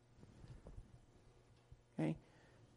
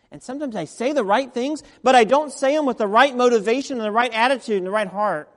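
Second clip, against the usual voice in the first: louder, higher preaching build; full-force preaching; 7 semitones higher, 14 dB louder than usual. Acted. Preacher pressing the point on the congregation, much louder. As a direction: drive it home from the pulpit.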